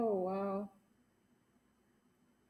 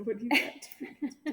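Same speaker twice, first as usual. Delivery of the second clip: laughing; 5 dB louder, slower than usual. Retold or imitated. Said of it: "I said".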